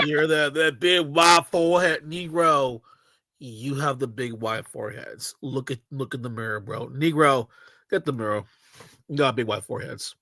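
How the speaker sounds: low taunting mock voice; mock deep voice. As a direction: taunt him in a deep goofy voice. Mocking a caller's forehead.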